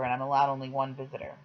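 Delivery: ordinary delivery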